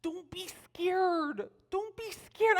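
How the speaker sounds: put-on high, drawn-out child's reassurance; imitated little girl. Little girl reassuring her babysitter, imitated child voice. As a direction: coax slowly in a high kid voice. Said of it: "you have to know her accent".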